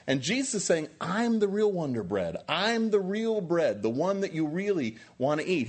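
impassioned pulpit preaching; fired-up preaching. Acted. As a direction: preach it hard, rising with conviction. Preaching at full force.

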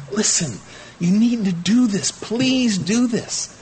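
high, drawn-out begging; desperate plea. Retold or imitated. Paul grabbing your shirt sleeve, begging you high and slow. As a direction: tug at the sleeve and plead, slowing hard on please. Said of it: "grabs your shirt sleeve and says".

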